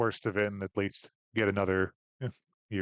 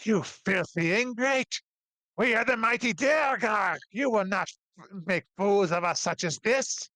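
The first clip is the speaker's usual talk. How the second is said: snarling dwarf; 10 semitones higher than usual. Acted. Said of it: "hissing and snarling".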